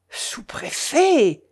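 high, drawn-out cry of astonishment; stretched, high-pitched exclamation; amazed exclamation. A narrator's reading. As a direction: cry out the title as if dazzled by it. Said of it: "s'écria le maire".

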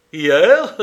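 loud, rising exclamation of disbelief; astonished cry. A narrator's reading. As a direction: cry it out as a startled question. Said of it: "s'écria Edward".

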